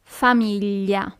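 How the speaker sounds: Famiglia slowly, fa-MEE-lya, each syllable clear; slow repetition. Shown as a demonstration. Teacher repeats Famiglia slowly for learners to copy. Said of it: "in Italian".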